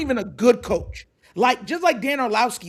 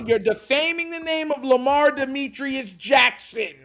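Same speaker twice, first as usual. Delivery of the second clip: ordinary talk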